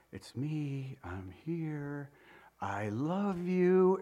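put-on hushed reassurance to a crying baby; soothing father. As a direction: softly comfort the infant, drawing out the last words. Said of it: "and he goes".

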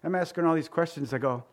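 usual speaking voice

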